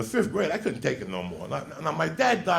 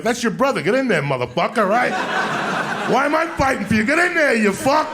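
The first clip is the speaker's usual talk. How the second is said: high, loud urging shout; shouting kid; 6 semitones higher, 4 dB louder than usual. Retold or imitated. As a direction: yell at the twin to fight. Kid yelling at twin.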